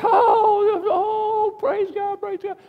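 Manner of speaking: very high, loud excited praise; imitating cheering churchgoers. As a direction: mimic a congregation cheering someone at the altar. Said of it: "people would".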